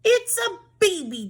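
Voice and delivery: high excited shout. Acted. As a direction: call it out loud and high. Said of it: "Dumbhead shouted".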